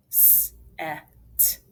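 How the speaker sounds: "S-, e-, t" as isolated letter sounds; three short sounds with gaps between; sounded out. Shown as a demonstration. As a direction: sound out set one phoneme at a time. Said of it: "Let's finger spell it".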